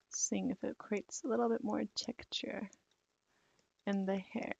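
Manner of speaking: soft whispered aside to herself. Real, not acted. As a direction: breathe it quietly while working. Muttering while testing a tool.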